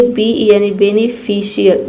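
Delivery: normal talking voice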